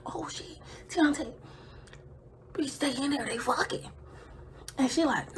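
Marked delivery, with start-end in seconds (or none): 0.0-3.9 soft urgent whisper; hushed alarm. Retold or imitated. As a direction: hiss his name without voice. Hiding at window, staying quiet.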